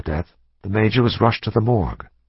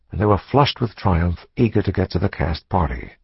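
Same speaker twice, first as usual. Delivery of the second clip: usual speaking voice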